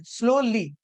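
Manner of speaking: "Slowly" with heavy SLOW, falling to lee; stress on first syllable. Shown as a demonstration. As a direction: lean hard on "slow" in "slowly". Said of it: "ज्यादा जोर दे रहे हैं". ("Slowly" में "slow" पर ज़्यादा ज़ोर)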